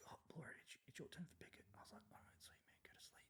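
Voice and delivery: hushed whisper so others sleep; whispered quote. Retold or imitated. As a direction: whisper it, sneaking at night. Night picket, whispering to avoid waking.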